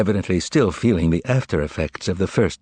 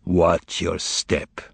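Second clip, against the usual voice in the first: a slow veiled threat to the cat; much slower than usual. Acted. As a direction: drop it slow and cold as a threat. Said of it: "was Voland's reply".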